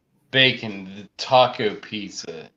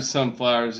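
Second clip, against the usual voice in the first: usual speaking voice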